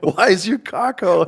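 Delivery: high, loud, drawn-out comic demand; mock outraged shout. First sergeant confronting the exposed soldier, mock outraged shout. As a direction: holler it as the flabbergasted sergeant, laughing.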